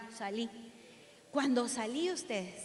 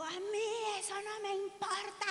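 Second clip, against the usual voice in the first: higher, imitated dismissive shout; mock guard yelling; 6 semitones higher, slower than usual. Guard refusing to let the mother in, imitated angry yell. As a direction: retell the guard's rude yell, pitched up. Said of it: "me ha pegado una gritada el hombre".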